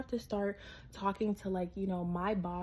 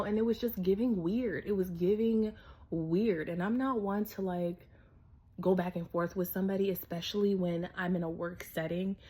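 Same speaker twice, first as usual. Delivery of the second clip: ordinary talk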